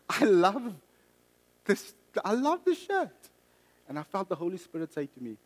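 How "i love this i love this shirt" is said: high, loud mock-anguished objection; pleading protest. Man resisting God's request to give away his shirt, pleading protest. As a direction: protest it dramatically, pitch shooting up. Acted protest to the Holy Spirit.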